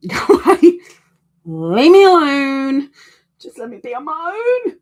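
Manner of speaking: high, loud squeaky mock shoo-off; playful mock grumble, high and loud. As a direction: shoo everyone away in a silly high voice. Hermit pushing people away, mock.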